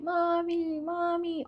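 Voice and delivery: put-on high wailing little girl; small kid crying mommy; child crying for mom. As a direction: call for mommy like a lost child. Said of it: "screaming, crying".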